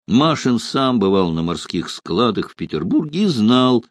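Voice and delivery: ordinary delivery, nothing marked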